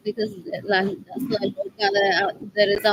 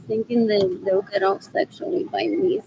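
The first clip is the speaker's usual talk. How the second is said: usual speaking voice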